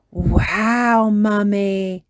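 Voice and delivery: loud amazed cheer. A narrator's reading. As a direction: wow her with delight. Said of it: "Peppa and George cheered".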